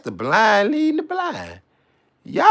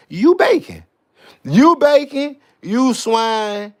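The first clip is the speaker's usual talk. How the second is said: yelled insult; 4 semitones higher, 6 dB louder, slower than usual. Acted. Insulting someone as a pig.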